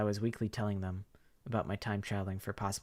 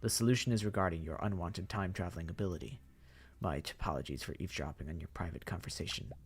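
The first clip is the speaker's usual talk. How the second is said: polite zombie mastermind; 3 semitones lower than usual. Acted. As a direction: menace under good manners. The Professor speaks.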